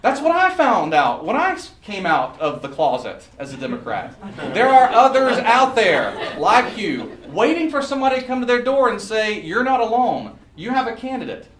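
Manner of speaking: high, loud rallying oratory; impassioned campaign-speech delivery; rousing stump speech. Real, not acted. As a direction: stretch the phrases out and rally the crowd. Rallies the crowd in stump speech.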